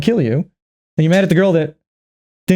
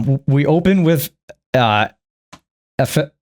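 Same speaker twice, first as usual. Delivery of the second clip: ordinary delivery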